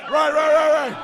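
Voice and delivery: loud call for attention over a crowd. Real, not acted.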